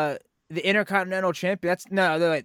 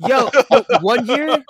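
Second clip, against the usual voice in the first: laughing; 6 semitones higher, 7 dB louder, slower than usual. Real, not acted. Excited start of a funny story.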